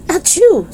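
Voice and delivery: high sharp sneeze; fake sneeze. Dennis sneezes on purpose.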